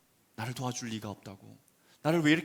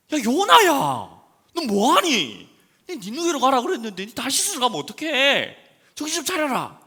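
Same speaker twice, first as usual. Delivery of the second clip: high, loud scolding of Jonah; mock scolding; 12 semitones higher, 14 dB louder than usual. Imagined God scolding Jonah, loud mock scolding. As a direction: scold him like a fed-up parent.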